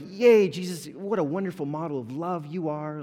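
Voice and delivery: loud, high sarcastic hooray; mock cheer. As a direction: cheer with hollow enthusiasm. Acts out insincere cheering of Jesus.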